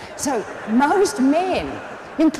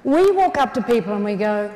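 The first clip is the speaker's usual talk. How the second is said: usual speaking voice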